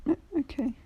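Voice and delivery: put-on high little boy voice; a meek child's okay; small boy. Boy calming down for the hero, small and high.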